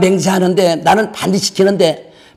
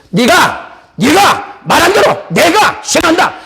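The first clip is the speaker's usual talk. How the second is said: high, slowed shouted proclamation; shouted warning; 9 semitones higher, slower than usual. Acted. Pastor repeats God's oath to the listeners, shouting.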